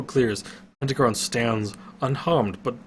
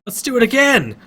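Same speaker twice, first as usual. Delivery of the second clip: excited; 13 semitones higher, 9 dB louder than usual. A narrator's reading. Fox thrilled after sliding.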